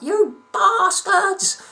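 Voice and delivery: put-on very high, loud, drawn-out old lady shriek. Old lady yelling at passing youths, slow high shriek. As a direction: screech it like an outraged old woman. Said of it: "she turns around and is like".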